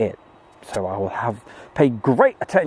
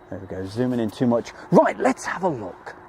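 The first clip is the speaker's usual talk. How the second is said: normal talking voice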